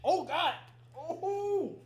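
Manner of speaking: loud high yelp from sour taste; sour shock shout. Real, not acted. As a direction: cry out at the sourness. Reacting to sour candy.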